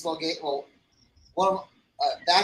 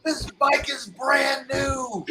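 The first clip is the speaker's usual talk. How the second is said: slow, high, mournful wail, imitated; lamenting; 7 semitones higher, much slower than usual. Injured biker mourning his new motorcycle, mock wail. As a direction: draw out his grief over the bike. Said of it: "The guy kept on going".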